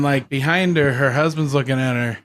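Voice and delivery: ordinary talk, nothing marked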